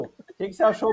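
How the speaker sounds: normal talking voice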